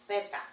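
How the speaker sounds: "zeta" with TH for the Z; Spain pronunciation. Shown as a demonstration. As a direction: say "zeta" as Spaniards do, "THEH-tah". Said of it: "they pronounce this".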